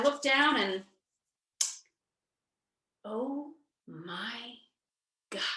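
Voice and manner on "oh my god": breathless, soft oh my God; stunned hush. Real, not acted. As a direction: barely voice it, floored. Seeing positive pregnancy test.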